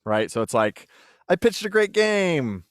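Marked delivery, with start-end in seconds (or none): 1.3-2.6 high, drawn-out cheer; elated mock boast. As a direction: crow it loudly, stretching the words. Imagined proud reaction to praise.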